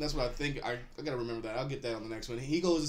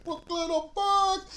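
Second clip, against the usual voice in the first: high, loud-pitched drawn-out comedian holler; bad Tracy Morgan impression; 4 dB louder, slower than usual. Arriving back in Brooklyn off the bus, bad impression holler. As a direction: holler it slowly in a hammed-up impression. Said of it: "That's my really bad Tracy Morgan".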